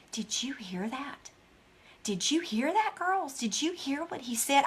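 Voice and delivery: put-on hushed, urgent whisper to little kids; intense whisper. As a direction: lean in close and whisper with awe. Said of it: "I'm talking like this to my children".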